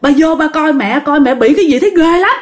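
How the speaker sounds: high-pitched, urgent retold plea; acted frightened child's call, pitched high; alarmed calling for father. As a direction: call Dad to come and look, in alarm. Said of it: "đi ra bạn kêu".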